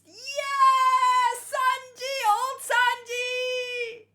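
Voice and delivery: high, loud, drawn-out joyful cry; tearful cheer. Real, not acted. Reactor crying with joy as old Sanji appears. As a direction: cheer through happy tears.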